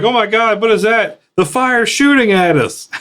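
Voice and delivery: high-pitched hammed-up alarm; mock panic. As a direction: imitate office workers freaking out at fireworks. Acting out panicked office workers.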